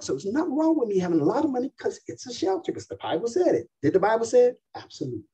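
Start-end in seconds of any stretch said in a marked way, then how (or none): 0.0-3.7 high, fast, smug imitation of the crowd; mock the money-loving listeners, high and quick; gloating crowd. Crowd cheering money as shelter, fast high smugness. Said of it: "Everybody was like".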